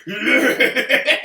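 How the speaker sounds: loud, drawn-out laughing yeah; retold scream. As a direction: relive jumping out of the chair with a cheer. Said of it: "I screamed".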